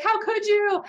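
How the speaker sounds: high-pitched mock wail; mock crying. Imitating a partner upset by unasked dirty talk, mock tearful protest. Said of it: "she's crying and she's like".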